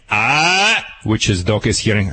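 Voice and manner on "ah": short sharp high "Ah!" like a dog's warning; sharp "Ah!" dog correction. Shown as a demonstration. Trainer demonstrating the correction sound to owners, sharp "Ah!". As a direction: bark out a sudden "Ah!". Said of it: "canine language of disapproval. Scream".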